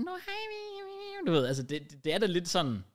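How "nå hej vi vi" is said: put-on squeaky childish TV voice; high mock kid voice. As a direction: chirp it like a kids' show host.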